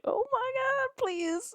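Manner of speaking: very high, slow, soft swoon; swooning squeal. Real, not acted. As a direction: squeak it out, melting. Swooning at hero's line.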